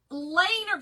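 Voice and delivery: loud, drawn-out Southern "Laner"; Southern accent. Shown as a demonstration. Crafter teasing her viewer about "leaner", exaggerated Southern accent. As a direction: play up the Southern vowel in "Laner". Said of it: "you know that Southern accent".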